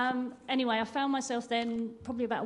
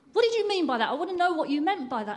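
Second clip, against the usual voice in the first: sharp, much higher and louder demand; angry confrontation; 6 semitones higher, 6 dB louder, faster than usual. Retold or imitated. One woman accusing the other in Marks and Spencer's, angry and loud. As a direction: confront her, voice up and hard.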